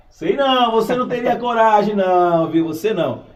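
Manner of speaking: mock-challenging, high and loud with a chuckle; playful teasing. Real, not acted. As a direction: tease the audience loudly, pitch up, breaking into a laugh. Host teasing the audience about courage.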